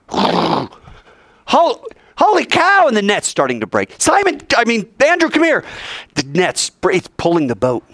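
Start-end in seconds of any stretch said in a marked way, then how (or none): 0.1-2.9 high, loud burst of amazement; excited exclamation. Acted. Fisherman seeing the nets fill, high amazed cry. 4.0-5.6 high-pitched, hurried summons; urgent call. Acted. Fisherman calling his brother to the nets, urgent call.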